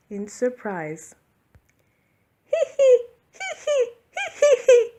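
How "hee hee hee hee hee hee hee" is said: high, loud giggling hee hee; high giggle. A narrator's reading. Santa laughing at the jack-in-the-box, high loud giggle. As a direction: giggle with delight. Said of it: "laughed Santa".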